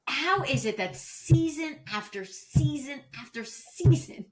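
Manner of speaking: slow, drawn-out disbelief; exasperated rant. Real, not acted. Co-host ranting about a reality star's stylist, slowly and emphatically exasperated. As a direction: drag out each phrase for emphasis.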